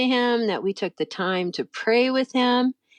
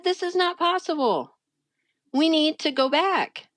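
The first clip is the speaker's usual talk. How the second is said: high, emphatic retold plea; dramatic insistence; 8 semitones higher than usual. Wife begging her husband to turn back, high dramatic insistence. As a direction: plead dramatically, pitched well up. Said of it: "you're being so dramatic".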